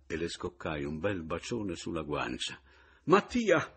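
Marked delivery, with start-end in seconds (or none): 3.0-3.7 a high, loud cry of the name; trembling shout. A narrator's reading. Pomino, jealous, shouting at Mattia as he kisses Romilda. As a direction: shout him off her, quivering. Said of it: "gridò Pomino fremente".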